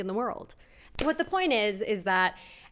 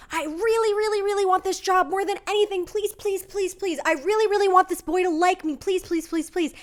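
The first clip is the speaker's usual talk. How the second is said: much higher, fast begging voice; pleading wish; 9 semitones higher, 4 dB louder than usual. Retold or imitated. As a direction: plead to the universe like a begging pet. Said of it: "you're like".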